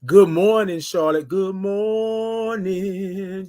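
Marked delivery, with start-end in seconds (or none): 1.3-3.5 drawn-out greeting. Shown as a demonstration.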